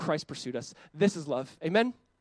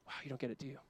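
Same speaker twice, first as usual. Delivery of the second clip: soft, much quieter, lower sigh of a reply; quiet rueful God; 4 semitones lower, 15 dB quieter, much faster than usual. Retold or imitated. God answers the demanding child, soft and rueful. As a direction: gently shake your head at the child. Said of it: "It's like".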